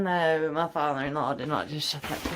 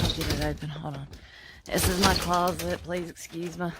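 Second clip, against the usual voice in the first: normal talking voice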